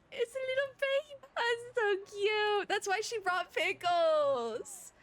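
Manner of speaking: high, tearful squeal, stretching the words out; gushing squeal. Real, not acted. Streamer squealing to chat over the baby dog, tearful and high. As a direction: melt over a tiny puppy.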